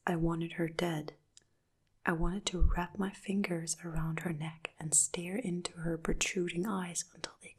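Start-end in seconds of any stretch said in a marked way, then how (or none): none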